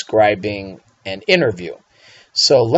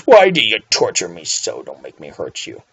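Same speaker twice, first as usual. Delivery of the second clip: mock menace; 6 semitones lower than usual. Teacher mock-threatening the bad U's.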